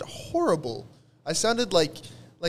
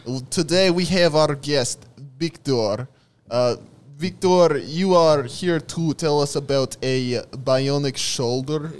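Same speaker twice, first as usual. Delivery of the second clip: loud mock Russian host; Russian accent; 3 dB louder than usual. Host opening Russia's best podcast, Russian accent. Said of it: "the Russian man with the accent".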